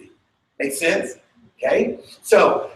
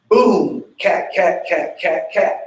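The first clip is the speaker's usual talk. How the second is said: punchy boom then quick rhythmic cat calls; vocalography cue; slower than usual. Shown as a demonstration. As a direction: call the step like a dance cue. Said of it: "The vocalography that goes with that is".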